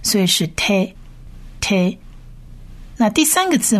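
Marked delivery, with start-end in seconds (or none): 0.5-2.0 te with a short "eh" vowel; short A syllable. Shown as a demonstration. Teacher joining tav and its vowel for learners, short te. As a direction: sound out te twice with a pause between. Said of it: "这个是短A的音".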